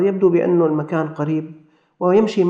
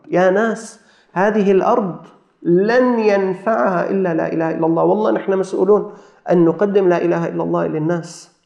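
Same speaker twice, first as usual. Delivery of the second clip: high, marvelling appeal to listeners; stirred preacher addressing the congregation; impassioned preaching; 6 semitones higher, 4 dB louder than usual. Real, not acted. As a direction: preach with fervour, lifting key phrases.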